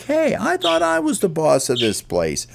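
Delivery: high, squeaky bird voice with a jealous edge; jealous Ollie. Acted. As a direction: sulk like a little bird who lost his spot. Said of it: "he's like".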